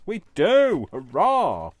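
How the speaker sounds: loud high cheer of triumph; excited. Real, not acted. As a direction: shout it out, thrilled it worked. The sewn pad turns out right.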